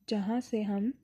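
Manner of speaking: ordinary talk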